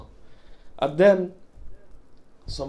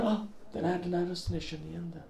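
hushed recognition; 10 dB quieter, faster than usual. Pretend. Infant recognising familiar voice.

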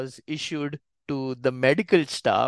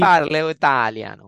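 loud, badly faked Italian accent; Brad Pitt's character; 6 dB louder than usual. Co-host butting into the story, mock Italian accent. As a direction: put on a hammy American-style Italian accent. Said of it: "Brad Pitt's character in Inglourious Basterds".

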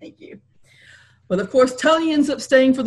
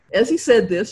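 ordinary delivery, nothing marked

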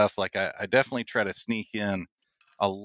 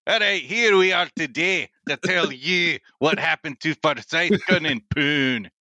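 hammed-up mock Scottish accent, pitched higher; Scotty McScotsman Scottish accent; 7 semitones higher than usual. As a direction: put on an over-the-top Scottish brogue for laughs. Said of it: "do the rest of the interview as Scotty McScotsman".

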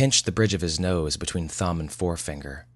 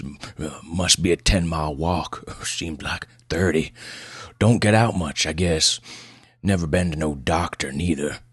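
put-on gruff backwoods old patient; gruff old man. Old patient tells doctor about his trip, gruff country voice. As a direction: grumble it out like an old mountain man. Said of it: "Pleasanton's voice was as gruff as his appearance".

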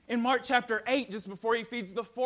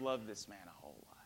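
quick, lower whisper; 12 semitones lower, 13 dB quieter, faster than usual. Acted. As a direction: drop suddenly to a hush. Drops to whisper.

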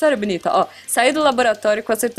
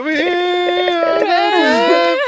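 laughing hard; 8 semitones higher, 3 dB louder than usual. Real, not acted. Host cracking up mid-story.

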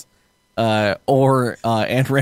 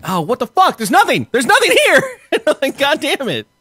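high, louder retold disbelief; mock exasperated shock; 12 semitones higher, 4 dB louder than usual. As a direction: squeal it out like you found nothing.

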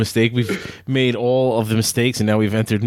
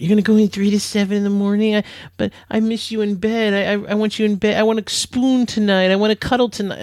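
lightly higher, pleading wife voice; a light mock of a clingy wife, pitched up; imitating wife; 7 semitones higher than usual. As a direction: whine it like the wife wanting cuddles. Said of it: "that's how women think".

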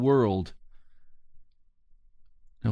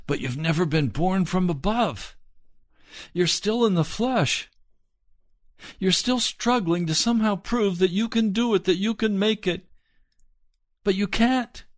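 rising preaching fervor; 9 semitones higher than usual. Acted.

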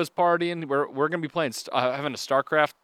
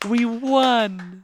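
mock surprised winner; 9 semitones higher, 5 dB louder than usual.

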